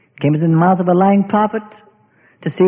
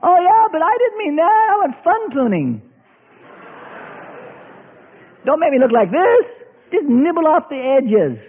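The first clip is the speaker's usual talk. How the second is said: higher, pleading voice; higher whiny bargaining; whiny believer; 6 semitones higher than usual. Acted. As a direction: bargain for gentle pruning. Believer backpedalling.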